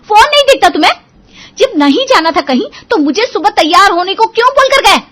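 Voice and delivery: high, loud scolding wife; furious high-pitched shout; angry yelling. Acted. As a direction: yell it accusingly at your husband. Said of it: "श्रुति चिल्ला पड़ती है".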